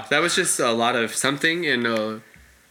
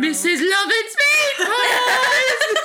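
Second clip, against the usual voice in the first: much higher, slow, laughing shout; mock shout; much slower than usual. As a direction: bellow it like a carnival barker. Hammy fake ad shout.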